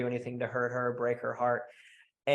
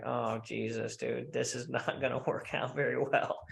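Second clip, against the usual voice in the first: low, soft, deflated aside with a laugh; mock dismay; 4 semitones lower, 7 dB quieter than usual. Reacting to a guy bargaining for sex, quiet mock dismay. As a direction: sigh it under your voice, amused.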